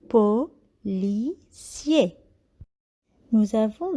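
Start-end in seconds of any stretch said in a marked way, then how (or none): 0.1-2.2 policier said very slowly as po-li-cier; teacher scanning a word for pupils; scanned by syllable. Shown as a demonstration. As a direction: beat out policier one syllable at a time. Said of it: "nous allons scander le nom".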